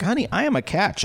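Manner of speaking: high-pitched comic comeback; hammed-up affronted retort; mock indignation. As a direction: play offended, pitched well up. Retold indignant comeback to her.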